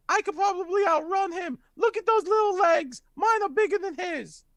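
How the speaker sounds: put-on very high-pitched cheeky kid voice; little boy. Little boy brags he can outrun the climber, squeaky kid voice. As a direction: squeak it out like a small boy boasting. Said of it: "little boy goes".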